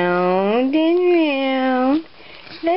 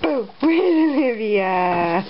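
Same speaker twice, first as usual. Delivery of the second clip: ordinary delivery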